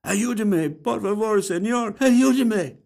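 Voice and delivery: high Spanish plea for help, rising; woman's plea. A narrator's reading. Ghost woman calling to a passerby, high and pleading. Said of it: "A woman's voice".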